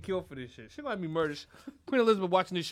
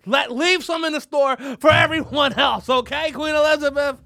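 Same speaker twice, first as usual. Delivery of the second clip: loud, high comic shout; mock scolding; 5 semitones higher, 9 dB louder than usual. Comic telling the queen to leave some, mock-exasperated shout. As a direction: yell it in exaggerated exasperation.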